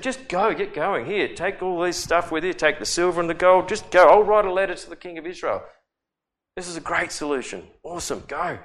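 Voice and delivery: lightly voiced king waving him off; eager king. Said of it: "he just said".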